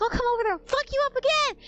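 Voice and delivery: mock threat. Boyfriend threatening the creep on the phone, mock angry. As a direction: imitate a hot-headed boyfriend threatening a beating.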